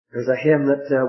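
ordinary delivery

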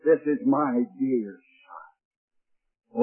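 slow, soft and hushed "This is my dear Son"; a tender whispered-down reverence. Acted. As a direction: savour each word quietly, lovingly. Preacher repeats God's words softly.

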